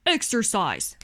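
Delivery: ordinary delivery, nothing marked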